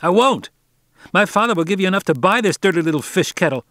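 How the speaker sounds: put-on a spoiled boy hurling an insult at the boat; boy shouting back. A narrator's reading. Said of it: "You've no call to shout".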